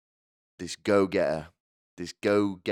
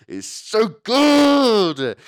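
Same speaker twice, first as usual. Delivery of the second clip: savouring delight; 11 dB louder, much slower than usual. Real, not acted. Relishing own dark joke.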